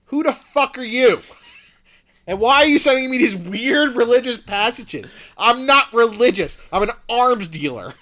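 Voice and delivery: loud, high outraged rant; hammed-up furious arms dealer; indignant demand. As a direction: belt it out, affronted and incredulous. Acts Max confronting Ethan.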